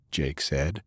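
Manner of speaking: ordinary delivery, nothing marked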